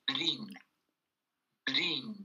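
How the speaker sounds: Brin with a clear I, no E before R; pronunciation demo. Shown as a demonstration. Teacher showing learners the clear I in Brin, pronunciation demo. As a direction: softly model Brin, each one set apart. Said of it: "je l'ai bien prononcé".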